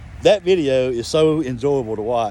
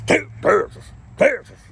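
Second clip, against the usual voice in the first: Texas stretched in a Texan drawl, pitched higher; 7 semitones higher than usual. Shown as a demonstration. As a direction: drag out Texas like a Texan would. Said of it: "like that".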